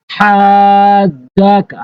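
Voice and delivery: হাজাকা with the first vowel drawn out very slowly; slow chanted recitation; madd stretch. Shown as a demonstration. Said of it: "এরপর দেখুন".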